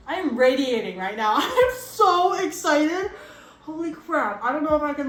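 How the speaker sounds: high, loud giddy squealing; bubbling over with delight; thrilled. Real, not acted. She watches the bleach change color, thrilled and squealing.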